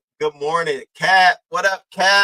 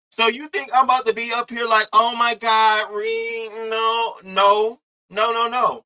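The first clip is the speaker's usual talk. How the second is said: high, slow "oh my God, Reed, no no"; hammed-up fake dismay; mock pleading; 9 semitones higher, 3 dB louder than usual. Telling Reed she won't fake sympathy, mock pleading. Mocking Reed's expected reaction.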